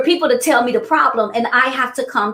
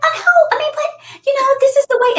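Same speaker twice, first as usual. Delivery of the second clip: much higher, sing-song reassurance; sugary colleague; 3 dB louder than usual. Retold or imitated. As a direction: chirp it like an overly sweet helper. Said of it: "she was like".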